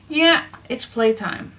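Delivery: usual speaking voice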